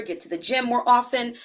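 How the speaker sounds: usual speaking voice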